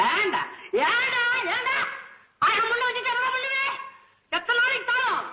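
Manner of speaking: very high-pitched berating wail; self-scolding wail. Acted. Poet alone scolds himself over the gold, self-scolding wail.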